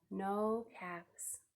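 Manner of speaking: hushed whisper of dismay. A narrator's reading. Storyteller to children, whispered dismay. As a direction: breathe it out, hushed. Caps missing.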